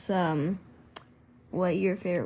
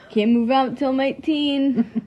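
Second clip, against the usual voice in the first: laughing; 6 semitones higher, 7 dB louder than usual. Real, not acted. Joking about being forced here.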